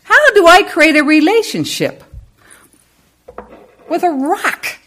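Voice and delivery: high, puzzled asking; skeptical listener. Retold or imitated. Congregation member asks how to relate to a rock, high and puzzled. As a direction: voice the doubter's confusion. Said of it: "some of you might be asking".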